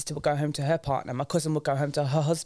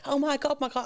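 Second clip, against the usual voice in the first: mock panic; 10 semitones higher than usual. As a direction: act out a mock alarmed reaction. Acts out panic she didn't feel.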